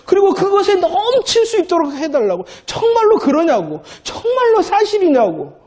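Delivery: high, pleading preacher's cry; rising fervent preaching. Real, not acted. Preacher pleading before the congregation, high fervent cry. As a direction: lift it high like a plea to God.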